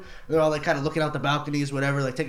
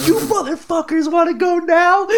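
much higher, louder, slower threat; a mock furious street challenge; menacing challenge; 12 semitones higher, 4 dB louder, slower than usual. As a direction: bellow it at the whole party. Machete guy at the door.

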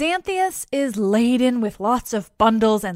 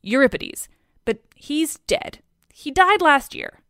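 puzzled, rising higher in protest; very confused; 6 semitones higher, 3 dB louder than usual. A narrator's reading. Heracles baffled by Dionysus wanting a dead poet, confused. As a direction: puzzle it out, pitch climbing. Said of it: "very confused".

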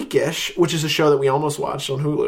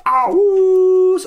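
high, sustained owl-like "oooo"; drawn-out mock hoot; 6 dB louder than usual. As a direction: stretch the word into a loud high hoot. Hoots within spooky Halloween outro.

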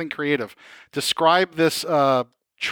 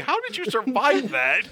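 higher-pitched astonished question; amazed; 6 semitones higher than usual. Acted. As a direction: ask it in mock amazement. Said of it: "looking at you amazed".

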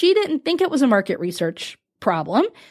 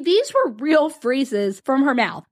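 ordinary talk

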